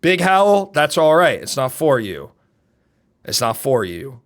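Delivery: ordinary delivery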